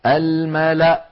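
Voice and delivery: slow "الملأ" ending on a glottal stop; وقف بهمزة ساكنة. Shown as a demonstration. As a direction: say al-mala' for "الملأ", no waw or alif sounded. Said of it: "هكذا، ولا تنطق الواو". (الوقف على «الملأ» بهمزة ساكنة)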